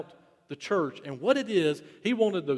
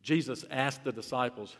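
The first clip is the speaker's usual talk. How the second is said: usual speaking voice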